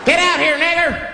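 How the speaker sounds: high-pitched hostile call; threatening yell. Acted. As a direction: yell a threat from outside, acted. Hooded men threaten the caller.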